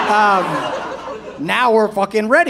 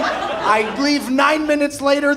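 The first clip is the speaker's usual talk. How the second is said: usual speaking voice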